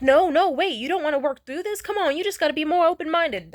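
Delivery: loud, high, imitated protest; mock pleading. Ex protesting a proposed breakup, mock pleading. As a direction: plead with exaggerated urgency.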